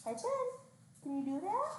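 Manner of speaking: high coaxing pet voice. Real, not acted. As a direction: sweet-talk a puppy. Coaxing dog trick.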